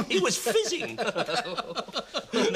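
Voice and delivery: laughing hard while telling the tale; high-pitched, amused storytelling breaking into laughter. Acted. Playwright reminiscing to a friend, laughing.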